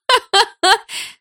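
high, loud cackling laugh; acted peal of laughter; mocking loud laugh. A narrator's reading. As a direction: burst out laughing at him. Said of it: "расхохоталась Малиновская".

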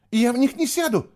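very high, loud squeal of refusal; panicked squeal. A narrator's reading. Terrified prisoner refusing to board the boat, squealing. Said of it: "завизжала она".

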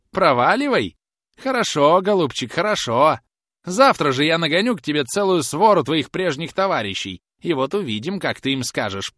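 high, loud outrage easing down at the end; indignant threat. Acted. Old woman threatens to bring his old gang, indignant. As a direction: throw the insult back, then threaten.

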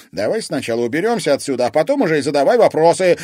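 fast, high, loud reply; urgent, rapid shout; shouting while running. A narrator's reading. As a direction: call it out on the run. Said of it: "крикнул ей на бегу Алекс".

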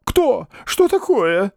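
high, alarmed questions; frightened old man's yelps; startled cry. A narrator's reading. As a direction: jolt as if grabbed from behind. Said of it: "закричал беспокойный старик".

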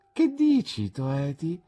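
a hint of an innocent young wife, asking; Dora's surprised question. A narrator's reading. Young wife looks up from her drawing, lightly voiced and innocent. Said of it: "chiese Dora".

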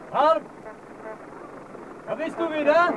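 loud call across the street. Acted. Manfred calling to Harm across the street, loud call. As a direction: hail him from afar.

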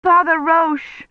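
trapped woman desperately calling the priest; desperate cry. A narrator's reading. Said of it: "she cried desperately".

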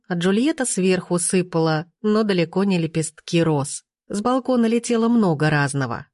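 plain reading voice

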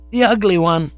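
put-on high, loud kid voice; definite child. A narrator's reading. Child singling out an actor for pop, high and definite. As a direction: insist as a small child. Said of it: "He became more definite".

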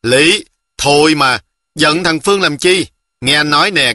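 plain reading voice

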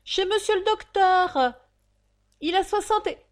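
high, loud crier's summons; insistent roll-call shout. Acted. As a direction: keep bellowing until cut off. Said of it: "l'aboyeur éleva la voix".

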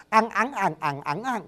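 "ăn, ắng, ẳng" recited as a row of tones; tone drill. Shown as a demonstration. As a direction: step "ẳng" through the tones. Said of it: "Tôi gọi là sinh âm là âm sống".

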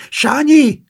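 loud high call for her grandson. A narrator's reading. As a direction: shout the name across the flat. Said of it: "kiáltotta".

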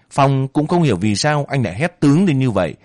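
ordinary delivery

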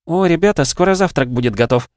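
put-on high, lively young woman's call; high-pitched chirpy announcement; cheerful friend. A narrator's reading. Voices Lera in dialogue.

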